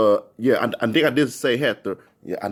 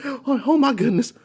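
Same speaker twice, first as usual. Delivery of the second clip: high, gasping disbelief; acted-out shaken exclaiming; scared and shaking; 8 semitones higher than usual. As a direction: catch your breath after a near crash. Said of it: "I was scared and shaking like".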